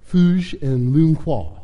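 ordinary delivery, nothing marked

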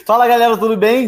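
animated greeting. Real, not acted. Host greeting, energetic.

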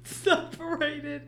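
high squeaky laugh-crying. Real, not acted. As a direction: wheeze through tears of laughter. Collapsing in laughter over retakes.

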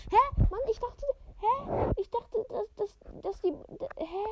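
high-pitched baffled hä; suddenly thrown by the text; confused surprise. Real, not acted.

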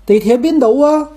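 high amateur Cantonese, rising question. Shown as a demonstration. Asking for the metro in Guangzhou, Cantonese. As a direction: ask it in tourist's Cantonese. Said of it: "去了广州我也能整两句儿".

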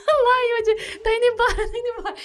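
high-pitched, hysterical laughing cry; re-enacted fit of giggles, voice way up. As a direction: squeal it through helpless laughter. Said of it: "E eu dando risada".

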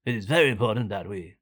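lightly voiced pompous Boris Johnson; a hint of the Prime Minister's address; mock Boris Johnson speech; Boris Johnson impression. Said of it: "Talking like this".

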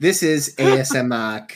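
chewing while speaking; words round a bite of food; mouth full. Real, not acted. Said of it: "are you eating still".

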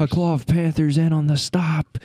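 playful sports radio call; mock radio play-by-play. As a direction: call the tackle like a radio announcer. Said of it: "good for, like, radio whenever you don't really know who made the tackle".

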